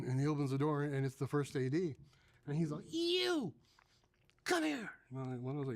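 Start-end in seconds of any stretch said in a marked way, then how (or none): 2.9-4.9 high, drawn-out indignant call; mock-angry summons. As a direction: imitate an assistant director calling out an offender. First AD summons him angrily.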